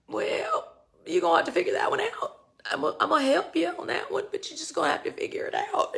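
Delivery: high, fast teasing God voice; voicing God. God teasing the seeker, high and quick. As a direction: rattle it off high as a playful God. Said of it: "God's like".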